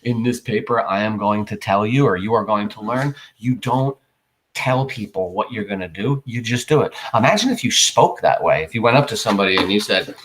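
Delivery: ordinary talk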